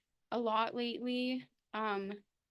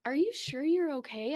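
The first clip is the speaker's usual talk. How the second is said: higher, louder questioning of a worried dad; retold worried question, pitched up; concerned dad; 5 semitones higher, 8 dB louder than usual. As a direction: check on someone, voice lifting up. Retold dad checks on daughter.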